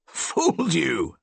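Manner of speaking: high, slow, gleeful crow of victory; triumphant cry. A narrator's reading. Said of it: "cried triumphantly".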